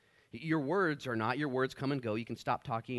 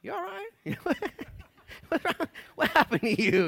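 much higher, laughing worried questions; higher, giggly mock concern; mock concerned people; 6 dB louder than usual. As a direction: tease the gloomy believer with fake concern. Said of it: "People are like".

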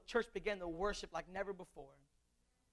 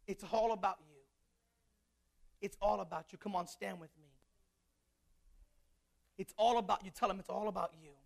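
pleading preacher altar call; 3 semitones lower, 4 dB quieter than usual. Acted. Altar call prayer, soft.

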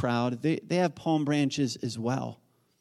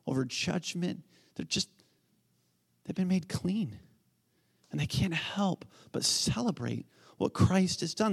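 ordinary talk, nothing marked

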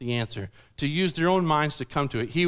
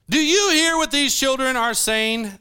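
indignant; 6 semitones higher than usual. A narrator's reading. Chief priests to Jesus, indignant raised-pitch challenge. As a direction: demand it of him, offended.

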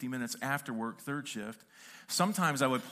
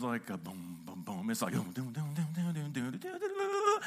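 soft, low boom-boom beatboxed drum line, climbing at the end; imitating a bass beat; 3 semitones lower, 3 dB quieter than usual. Shown as a demonstration. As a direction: hum out the bluesy beat. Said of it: "this song is just like".

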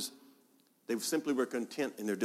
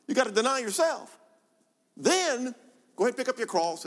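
much higher, punchy preaching lift; excited emphasis; 11 semitones higher, 3 dB louder than usual. Acted. Preacher spelling out Jesus' demands, high and excited. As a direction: lift it high as if it's outrageous.

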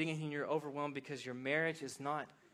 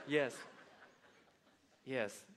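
ordinary talk, nothing marked

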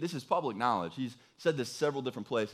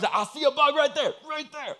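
mock bug spotter; 12 semitones higher, 6 dB louder than usual. Mimicking bug-spotting eagle-eyed people.

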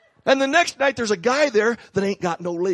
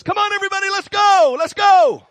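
high, loud rallying shout rising to a yell; hyped-up evangelist; 13 semitones higher, 8 dB louder than usual. Retold or imitated. Preacher mimicking an evangelist rallying the church, loud high shout. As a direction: whip the crowd up like a fired-up evangelist. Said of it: "And the evangelist says".

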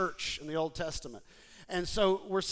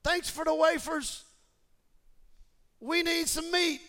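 high, louder, sarcastic thanks; mock ungrateful; 9 semitones higher, 4 dB louder than usual. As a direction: sneer at the manna like a brat. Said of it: "They're like".

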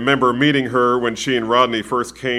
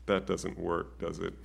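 softer, sheepish little question, trailing away; 6 semitones lower, 13 dB quieter than usual. Retold or imitated. Pastor voicing Dana admitting her answer fails, sheepish and soft. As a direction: say it like you already know the answer. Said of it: "she looked at me rather sheepishly".

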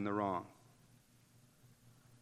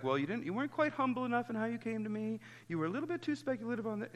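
higher, fast, nitpicking complaint; mock defensive whine; 8 semitones higher, 5 dB quieter, much faster than usual. Preacher mocking a defensive listener, higher and fast. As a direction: rattle off the excuses like a prickly sinner. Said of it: "don't be like".

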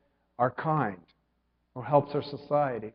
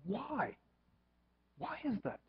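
higher and much softer, asking yourself; a hushed inner why; soft wondering question; 9 semitones higher, 13 dB quieter than usual. Retold or imitated. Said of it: "you have to ask yourself".

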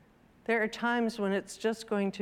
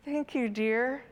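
mildly feeble motherly thanks; weak thanks; 3 semitones higher than usual. Acted. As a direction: thank the child with little enthusiasm. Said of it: "Missus Large said weakly".

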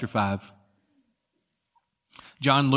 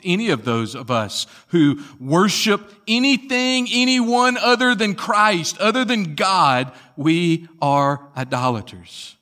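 high, loud sermon delivery; emphatic preaching; 6 semitones higher, 6 dB louder than usual. Real, not acted. Preacher warning the congregation about idolatry, emphatic preaching. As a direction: drive it home from the pulpit.

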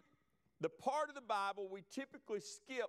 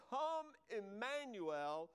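slow reverent; 3 semitones higher, much slower than usual. Shown as a demonstration. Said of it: "are the first words to Ruth".

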